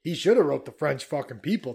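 ordinary talk

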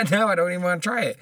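ordinary talk, nothing marked

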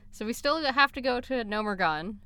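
ordinary delivery

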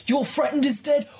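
panicked high yell; freaking out. Acted. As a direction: shriek it in panic. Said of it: "he's fucking freaking out, going".

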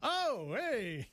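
high-pitched pretend surprise; playfully startled hey; mock shocked greeting. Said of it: "That's how I interpret that".

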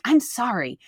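put-on sing-song sarcastic sorry like a sulky kid; snarky apology. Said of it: "real snarky".

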